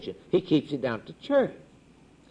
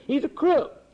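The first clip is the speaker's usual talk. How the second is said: preacher's outcry; 11 semitones higher than usual. Real, not acted. Preacher denouncing the hypocrite.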